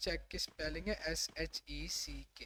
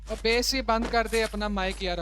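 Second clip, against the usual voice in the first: shouting; 4 semitones higher, 10 dB louder than usual. Real, not acted. Yelling at teammate mid-fight.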